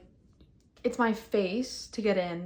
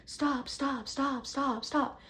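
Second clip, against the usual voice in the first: higher, urgent mock yelling; retold yell; 6 semitones higher, much slower than usual. Running toward both shooters, yelling at them. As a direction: call out to stop the shooting. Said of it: "I'm yelling at both of them".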